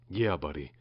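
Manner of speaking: low, soft, choked-up voice. A narrator's reading. Jake to dying Finn, a touch of choked-back tears. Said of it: "choked Jake".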